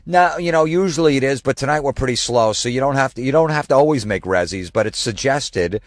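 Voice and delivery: high, loud impression of a chatty hostess; imitating a girl at the host desk; mimicked hostess. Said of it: "And then the girl goes".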